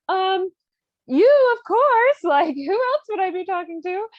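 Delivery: very high, loud mock innocence; overdone, high-pitched bluffing with a laugh; flustered cover-up. Countess covering up to her jealous husband, flustered and high.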